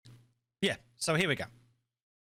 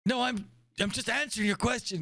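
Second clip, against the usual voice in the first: put-on high, insistent old admiral; crotchety old commander explaining; 10 semitones higher than usual. Plays old admiral character.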